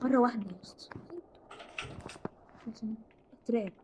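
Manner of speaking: unvoiced hush so the nun won't hear; whisper. Real, not acted. Gamer hiding from the nun, whispering.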